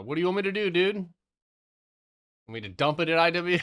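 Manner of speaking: loud high playful call to his friend; hyped shout to a buddy on the stream; excited teasing. Real, not acted. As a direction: tease the homie who is listening. Playfully addressing listening friend.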